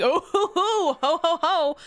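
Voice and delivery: high, bouncy ho-ho-ho; playful scooping noises. Acted. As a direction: whoop lightly as you bundle up a wriggling cat. Acts out scooping cat in towel.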